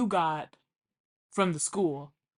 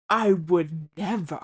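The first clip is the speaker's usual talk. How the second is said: offended dad; 2 semitones lower, 4 dB louder, slower than usual. Retold or imitated. Dad refusing tap water.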